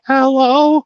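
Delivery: put-on high, startled old lady hello; frail old lady. A troll phones the old lady at night, high and startled. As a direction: pick up the phone like a sweet old granny.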